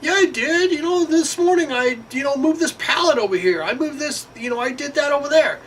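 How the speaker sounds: very high, hammed-up excuse voice; mock lazy coworker. Coworker listing his little tasks, high mocking voice. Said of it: "You don't ever work".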